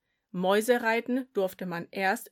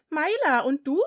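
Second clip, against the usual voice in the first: put-on higher, louder girl voice; friendly young girl; 7 semitones higher, 7 dB louder than usual. A narrator's reading.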